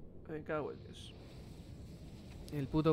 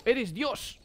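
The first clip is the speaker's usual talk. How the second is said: raised, loud cheer for a hero; excited cheer; 9 semitones higher, 5 dB louder than usual. Retold or imitated. Telling a player mid-game, excited shout. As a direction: hail him like a god. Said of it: "Y le digo".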